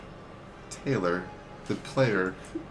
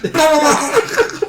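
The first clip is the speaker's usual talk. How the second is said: high, loud babbled screaming; mock screamed blahs; 12 dB louder, much slower than usual. Imitating people high off the noise, mock scream. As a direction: scream out drawn-out nonsense, laughing. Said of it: "they're screaming it like".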